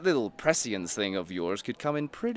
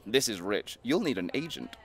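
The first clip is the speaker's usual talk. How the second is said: usual speaking voice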